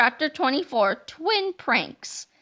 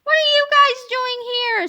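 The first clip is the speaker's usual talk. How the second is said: higher, louder scolding question; stern; 9 semitones higher, 6 dB louder than usual. Acted. Yesterday George scolds his other self, sternly and loud. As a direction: demand it like a stern boss. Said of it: "said Yesterday George sternly".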